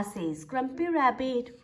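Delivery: ordinary delivery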